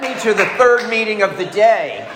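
half-laughing desperation for the next meeting; a laughing, desperate mock plea; frantic laughing cry. Frantic early-sobriety meeting hunt.

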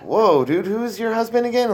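high, lightly mocking startled friend; baffled guests. Guests puzzled by the host's drinking, a hint of mock alarm. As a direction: act out the friends' puzzled reaction. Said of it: "they're like".

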